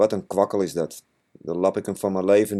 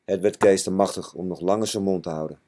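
ordinary delivery, nothing marked

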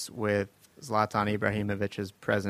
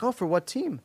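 higher, eager question; curious fan; 11 semitones higher than usual. Retold or imitated. Lobby guy asking the star his team, higher eager voice. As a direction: ask it like a keen stranger. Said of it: "the guy was like".

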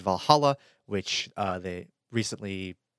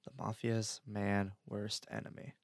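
slow, softer, half-whispered repeat; mock ominous echo; 10 dB quieter than usual. Echoing cohost's mafia joke, slow hushed murmur. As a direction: murmur it back like a dark secret.

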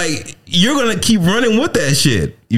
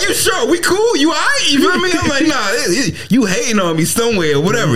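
much higher, whiny friend-check; high mock hurt; 11 semitones higher than usual. Artist questioning his critic, high mock hurt. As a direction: play it wounded and squeaky.

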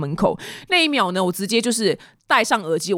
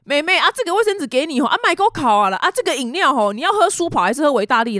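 higher-pitched, kindly uncle imitation; neighbour uncle; 6 semitones higher than usual. Neighbour uncle hands over tissues and drinks, high fussing imitation. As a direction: offer help like a fussing local uncle. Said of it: "阿伯就说".

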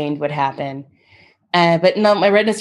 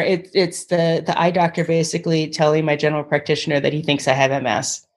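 ordinary talk, nothing marked

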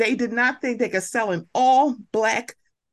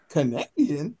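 mock disbelief; 6 semitones lower than usual.

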